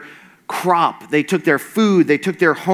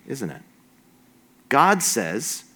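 normal talking voice

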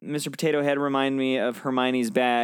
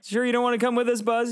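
lightly voiced, higher and quick; hurried, higher-pitched invitation; acted toy character; 11 semitones higher, faster than usual. Toys asking Buzz to come along, higher acted voice.

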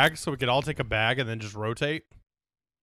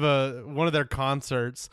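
usual speaking voice

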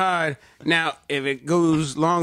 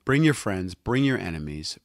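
sultry promo read; 6 semitones lower, 4 dB quieter than usual. Acted. Podcast intro promo read.

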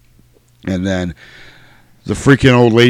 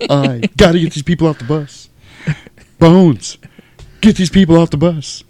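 halting, higher hammed-up delivery; mock starship captain; 6 semitones higher than usual. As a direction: declaim in dramatic chunks with odd pauses. Mock Star Trek captain on the bus.

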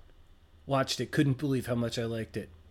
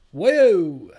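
whoa; 6 semitones higher, 13 dB louder than usual. Acted. Mimics a trick effect.

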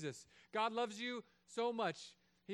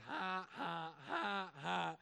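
mocking kid laugh; much slower than usual. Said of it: "and it goes".

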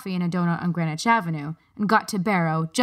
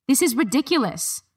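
exasperated; 7 semitones higher than usual. A narrator's reading. Harriet protests to guards.

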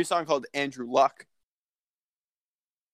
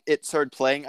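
normal talking voice

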